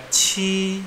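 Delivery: "ci" with a puff of air, held high and level; aspirated Q. Shown as a demonstration. Teacher showing how Q is read, aspirated "ci". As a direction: stretch "ci" with breath after the consonant. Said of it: "ada tekanan udaranya".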